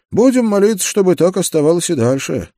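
high, loud character reply. A narrator's reading. Partner answers the lookout, high and loud. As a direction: lift it well up and project. Partner's line in narrated dialogue.

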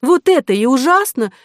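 high, loud, indignant complaint; wronged heroine's heated exclamation; indignant cry. A narrator's reading. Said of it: "крикнула Ариадна".

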